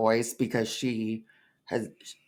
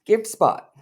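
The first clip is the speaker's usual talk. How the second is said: higher, pushy; mock cow voice; 8 semitones higher, 3 dB louder than usual. Teasing her cow clip, first take, pushy. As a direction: say it like a plea. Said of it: "you showed, like".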